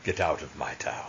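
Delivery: low, soft menace in a put-on voice; hushed, low-pitched villain line; Simcoe impression. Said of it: "full Simcoe".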